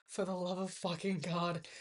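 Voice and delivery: low, hushed plea through strained patience; mock seething, very soft and deep; exasperated under the breath. Exasperated retelling of helping pick food.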